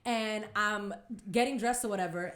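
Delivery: ordinary talk, nothing marked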